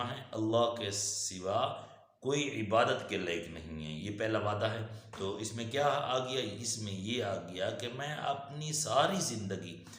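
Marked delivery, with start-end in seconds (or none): none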